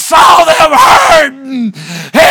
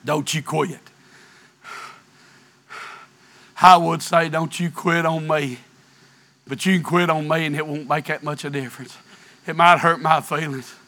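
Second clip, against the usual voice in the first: low, much softer pleading voice; hushed plea; 12 semitones lower, 12 dB quieter, faster than usual. Real, not acted. Preacher begging the congregation not to quit, low and soft. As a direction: drop to a near-whisper and plead with them.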